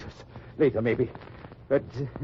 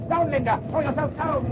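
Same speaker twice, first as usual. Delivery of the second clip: urgent shout; 12 semitones higher than usual. Acted. Bombs dropping, urgent command.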